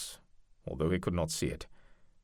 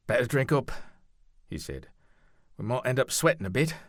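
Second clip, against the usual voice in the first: higher, lightly voiced comrade; friendly soldier, a touch higher; 6 semitones higher, 4 dB louder than usual. Noel's voice in narration.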